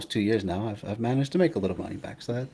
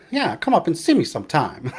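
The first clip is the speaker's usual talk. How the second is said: Mae West impression; 7 semitones higher, 4 dB louder than usual. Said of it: "Alaska's Mae West".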